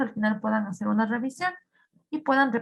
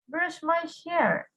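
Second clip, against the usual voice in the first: ordinary talk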